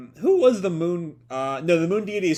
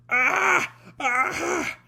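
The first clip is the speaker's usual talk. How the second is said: high, sharp cries of pain from a wound; pained scream; 14 semitones higher, 4 dB louder than usual. Acted. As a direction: scream as if sliced open. Selene's gut is sliced open.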